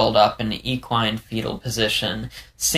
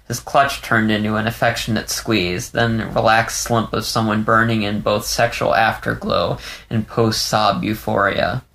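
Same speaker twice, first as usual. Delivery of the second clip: ordinary delivery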